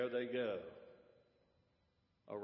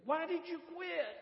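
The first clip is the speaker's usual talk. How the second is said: high, incredulous acted outburst; astonished question; 14 semitones higher than usual. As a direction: demand a reason in disbelief, pitched way up. Astonished preacher in story.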